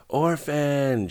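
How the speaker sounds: high-pitched, drawn-out cry of a name; mild narrated scream, pitched well up. A narrator's reading. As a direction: scream it out, understated, as a narrator would. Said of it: "she screamed".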